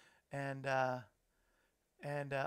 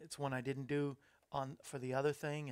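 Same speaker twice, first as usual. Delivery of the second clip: usual speaking voice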